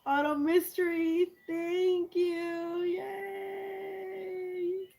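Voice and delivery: high, thrilled squeal of thanks; high-pitched happy yay; excited squeal. Real, not acted. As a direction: shriek with delight at the gift. Squeals opening a new gift.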